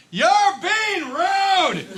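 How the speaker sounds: mock scream of a girl standing up. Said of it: "she screamed this at the top".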